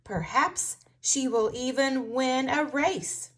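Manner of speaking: usual speaking voice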